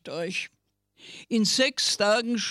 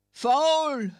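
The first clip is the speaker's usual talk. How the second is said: coyotes howling; 6 semitones higher than usual. Acted. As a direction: howl it out like a coyote. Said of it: "heulten die Kojoten".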